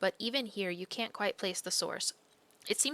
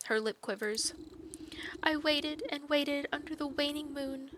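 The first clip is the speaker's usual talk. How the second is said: put-on higher, dreamy young maiden voice; lovesick maiden; 8 semitones higher than usual. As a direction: tell it like a pining girl. Maiden character voice.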